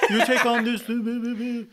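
high put-on French babble; mock French accent. Visitor taking on French speech, mock French accent. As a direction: send up French speech in nonsense syllables. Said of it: "making fun of the French".

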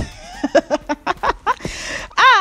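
shrieking laugh at her own folly; real laughter at the embarrassing memory; high burst of laughter. Real, not acted. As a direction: laugh out high and loud at yourself. Laughing at herself.

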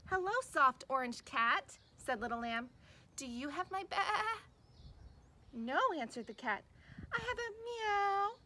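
put-on lively storybook read for little kids, big character voices; reading to children. Teacher reading a picture book to kids, animated storytelling. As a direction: read it like circle time, animated and slow.

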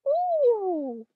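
very high, breathless ooh; awed ooh. Retold or imitated. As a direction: coo in amazement again like Cinderella. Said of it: "Cinderella continues to go".

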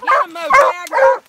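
loud, high, slow yell to the dogs; shouting at dogs. Real, not acted. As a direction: urge the dogs on hard. Urging hunting dogs on.

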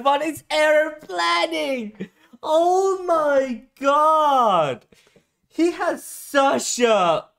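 squealing with disbelief at the twist; excited high voice. Real, not acted. Fan reacting to a shocking scene, voice high and thrilled. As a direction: pitch jumps way up in stunned excitement. Stunned by twist while watching.

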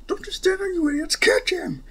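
high, shrill-pitched orders barked at the goons; angry yelling. Acted. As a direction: yell at useless henchmen. Villain yelling at his henchmen.

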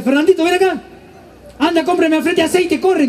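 mock mom sending the kid to the store; mother calling son. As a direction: call him like a bossy mom.

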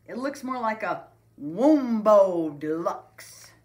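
loud, drawn-out mocking voice; teasing. A narrator's reading. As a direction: stretch out the silly name to tease. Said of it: "teased Buster".

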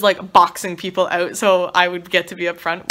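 ordinary delivery

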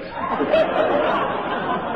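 a high, breathy cackle at his own joke. Acted. Corporal cackling at his own joke about kidnapping. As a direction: laugh it off high and airy.

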